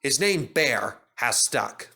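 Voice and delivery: ordinary delivery, nothing marked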